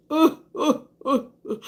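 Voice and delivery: high, loud mock wails; loud, high imitated sobbing oh's; imitating her crying. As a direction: echo the sound of her crying. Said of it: "It just sound like a cry".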